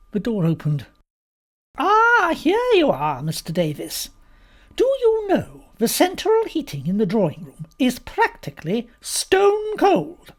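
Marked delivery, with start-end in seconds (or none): 1.8-10.3 high, loud complaining guest; indignant Mrs Boyle. A narrator's reading. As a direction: pounce on the host with a grand complaint.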